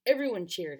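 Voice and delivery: plain reading voice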